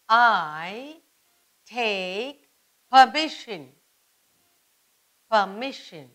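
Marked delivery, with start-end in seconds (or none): none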